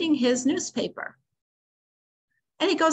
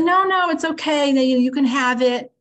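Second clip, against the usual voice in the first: eager insistence; 4 semitones higher, 7 dB louder than usual. Retold or imitated. Friend eagerly reconciles.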